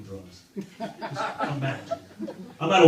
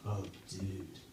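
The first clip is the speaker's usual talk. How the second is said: stoner dude; 5 semitones lower, 5 dB quieter than usual. Retold or imitated. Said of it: "he'd be like".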